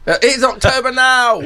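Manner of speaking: excited. Real, not acted. Realising birthday month is now.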